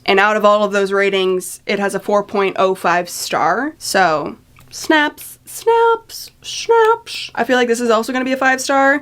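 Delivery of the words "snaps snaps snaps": high squeaky sing-song snaps; high-pitched snaps. Acted. Cheering a book's high rating in a squeaky voice.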